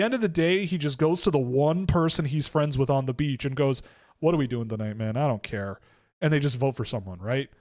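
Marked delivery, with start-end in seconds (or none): 4.2-5.8 lazy mock Tony. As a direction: imitate a guy who couldn't care less. Laid-back Tony shrugging off strategy.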